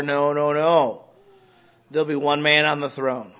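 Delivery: loud, emphatic sermon delivery; forceful preaching. Acted. Pastor declaring God's rule to the congregation, forceful preaching. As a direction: drive each point home from the pulpit. Preaching about one government.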